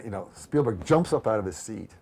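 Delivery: ordinary talk, nothing marked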